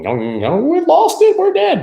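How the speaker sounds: very high, loud fake wail; mock panic. Trader play-acts panic over a falling chart, mock panic. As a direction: squeal it in hammed-up despair.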